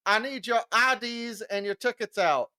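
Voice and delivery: high-pitched official calling to a crowd. Retold or imitated. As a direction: call it out like a checkpoint worker. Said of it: "hear him calling out".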